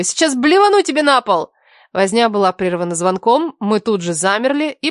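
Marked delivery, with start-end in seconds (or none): 0.0-1.5 high, desperate warning; high-pitched helpless protest; pleading. A narrator's reading. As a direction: beg in a raised pitch, as if being tickled. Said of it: "умоляла её".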